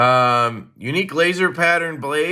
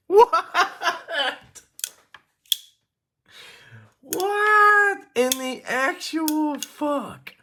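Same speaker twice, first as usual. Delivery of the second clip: high, drawn-out disbelief; stunned disbelief; 13 semitones higher, much slower than usual. Real, not acted. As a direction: stretch it out, totally floored. Stunned by the mechanism.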